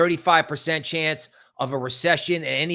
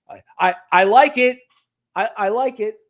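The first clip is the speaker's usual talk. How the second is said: You're like; 6 semitones higher, 6 dB louder than usual. Retold or imitated. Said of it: "You're like".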